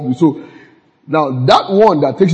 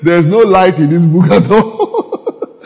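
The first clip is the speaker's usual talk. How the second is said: laughing through it; 4 semitones higher, 4 dB louder, slower than usual. Retold or imitated. Quoting while laughing.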